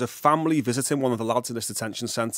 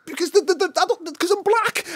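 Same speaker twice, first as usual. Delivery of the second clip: flustered insistence; 3 dB louder than usual. Retold or imitated. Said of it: "I'm saying".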